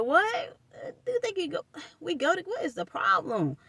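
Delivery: high-pitched disbelieving question; shocked what. Retold or imitated. Retelling her reply to him, high and incredulous.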